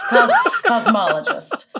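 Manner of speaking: laughing, says "cosmologist" as the right word; laughing correction. Shown as a demonstration. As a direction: chuckle through the fix "cosmologist". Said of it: "Sorry, it's my job".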